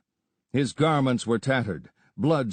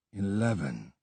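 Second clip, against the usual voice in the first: much softer muttered word; 3 semitones lower, 7 dB quieter than usual. A narrator's reading. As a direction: mutter it under your breath. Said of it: "he muttered".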